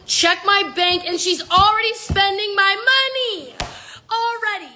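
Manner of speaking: stunned that the money is already gone; outraged disbelief. Real, not acted. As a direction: let the outrage climb as you say it. Sees stranger spending her money.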